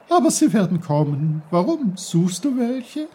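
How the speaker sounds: high, loud, bubbly babbling voice; a merry stream calling out; cheerful brook. Acted. The brook greets Peter loudly and cheerfully.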